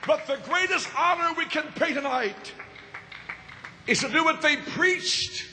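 high-pitched, emphatic tribute from the pulpit; impassioned preaching. Real, not acted. Preacher honoring beloved leaders before the crowd, impassioned preaching. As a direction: lift the pitch and proclaim it.

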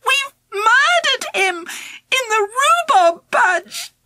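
high, slow, terrified near-crying cry; panicked shout. Acted. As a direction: wail it out in terror. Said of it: "I shouted".

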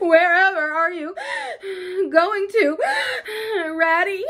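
high, loud wail of alarm; tearful cry. A narrator's reading. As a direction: call out through sobs, halting between phrases. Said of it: "cried the tearful Mole".

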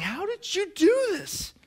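very high, drawn-out mock-aghast question; exasperated disbelief. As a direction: wail it like an appalled parent. Parent confronts kids over mess.

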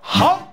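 high chomp sound; munching hump. Acted. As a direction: chomp like a hungry bug. Caterpillar eating sound.

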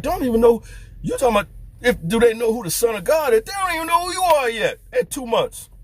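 mock the idea with rising disbelief; incredulous preaching.

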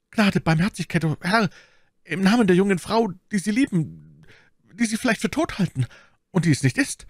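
high, halting begging; stammered plea. Acted. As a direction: plead for mercy in broken phrases. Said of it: "stammelte der Mörder".

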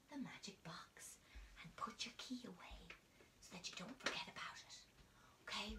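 hushed conspiratorial whisper to the class; secret whisper. Acted. Teacher whispering the magic box ritual to children.

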